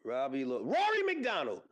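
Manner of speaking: normal talking voice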